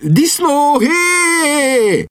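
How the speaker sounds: very high, long-held call; hog call. A narrator's reading. Lord demonstrating the hog call to young man, calling out. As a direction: sing out a drawn-out, high-pitched hog call.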